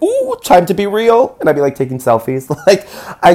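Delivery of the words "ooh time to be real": high mock-earnest coo; mock serious. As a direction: tease the life coach in a high mocking voice.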